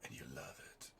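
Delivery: low, hushed smoker's rasp; playfully sultry, raspy and barely voiced; sexy smoker voice. Real, not acted. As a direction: drop into a soft, husky near-whisper. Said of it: "it's my sexy smoker voice".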